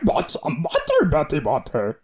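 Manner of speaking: mock Yoda voice. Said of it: "Do it in Yoda's voice".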